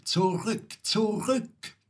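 high, climbing bird's warning; urgent high-pitched call to turn back. A narrator's reading. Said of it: "Die Nachtigall ruft".

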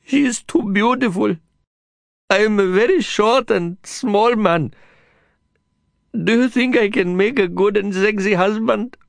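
stuttering sobs. Acted. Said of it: "His voice came in stuttering sobs".

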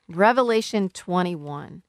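normal talking voice

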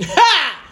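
high laugh. Real, not acted. Laughing at the letter.

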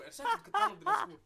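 hammed-up pushy saleswoman; mimicking the saleswoman. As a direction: squeal it out like a pushy shop assistant. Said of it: "Hon bara".

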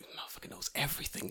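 whispered panicked swearing; hushed disbelief. Retold or imitated. Sweating teacher realizing student knows everything, panicked whisper. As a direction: hiss it under the breath.

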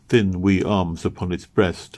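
usual speaking voice

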